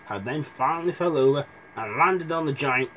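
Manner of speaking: usual speaking voice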